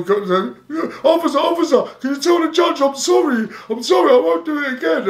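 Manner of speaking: squealing high, tearful plea; mock begging. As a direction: imitate a sobbing teenager begging an officer. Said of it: "Begging for my life".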